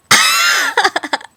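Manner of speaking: high, loud shriek of laughter; burst of laughter. Real, not acted. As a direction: break up into a squeal of laughter. Friend's absurd fart question.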